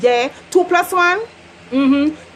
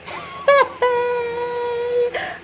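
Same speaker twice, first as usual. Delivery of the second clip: high laughing wail; laughing sob; 10 semitones higher than usual. Real, not acted. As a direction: laugh till it sounds like crying. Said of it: "laughing till crying".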